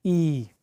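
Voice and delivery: long drawn-out ई; prolonged i as in tree. Shown as a demonstration. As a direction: stretch the ई long. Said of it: "थोड़ा सा इसको लंबा खींचना है".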